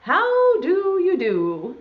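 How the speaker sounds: high, slow singsong greeting; pig's greeting. Acted. Pig greeting the rooster in a poem, singsong. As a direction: draw it out in a high storybook voice. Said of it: "said the pig".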